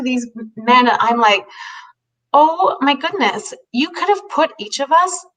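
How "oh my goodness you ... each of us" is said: higher and slow, dawning amazement; amazed realization. Real, not acted. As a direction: let the realization land slowly. Sudden realization at the table.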